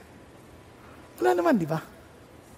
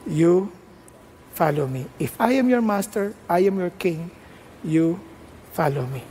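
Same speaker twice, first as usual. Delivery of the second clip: low, deliberate divine authority; God's firm command; 5 semitones lower than usual. Acted. God telling His servant to follow, low firm command. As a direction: speak low as master and king, phrase by phrase.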